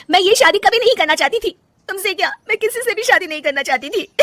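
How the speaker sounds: high, loud outburst; wife admitting to her husband she never wanted the marriage; bitter confession. Acted. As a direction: spit out the truth she hid.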